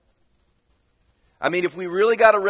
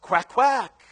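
higher-pitched congregation quacks; louder duck quacking; 6 semitones higher than usual. Acted. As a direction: quack back a bit louder. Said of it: "And a little bit louder".

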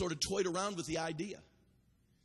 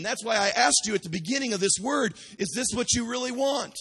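full-force preaching; 5 semitones higher, 8 dB louder than usual. Acted. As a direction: preach it out hard to the crowd. Fiery sermon at full force.

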